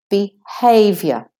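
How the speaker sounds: behaviour as Australian be-HAY-vyuh, no final R; slow non-rhotic Australian behaviour, stress on HAY; Australian pronunciation. Shown as a demonstration. Teacher modelling the word for learners, Australian behaviour. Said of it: "if you're doing the American".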